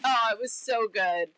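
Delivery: ordinary delivery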